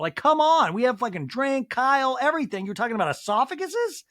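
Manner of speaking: high, loud exasperated rant; exasperated disbelief. Real, not acted. Host exasperated at the boring topic.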